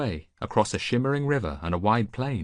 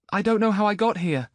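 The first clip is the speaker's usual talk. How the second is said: put-on much higher, louder boy's voice; young boy; 14 semitones higher, 6 dB louder than usual. Boy to Antiochus, high bewildered voice. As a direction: sound lost and bewildered.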